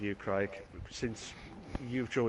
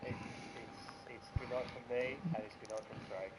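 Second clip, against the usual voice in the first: much softer, almost whispered "good night"; hushed sign-off; 12 dB quieter than usual. Acted. Co-host signs off for both of them, much softer, nearly whispered. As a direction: wind the show down in a hush.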